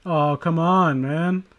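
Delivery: usual speaking voice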